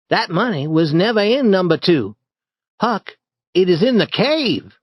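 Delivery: put-on a bright boy sharing a secret; Tom.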